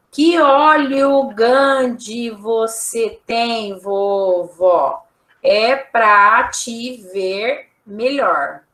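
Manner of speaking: put-on very slow, word by word, like decoding a child's writing; slow read-aloud of a child's text. Shown as a demonstration. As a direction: read it out slowly, each word spaced. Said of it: "Olha a historinha da Chapeuzinho".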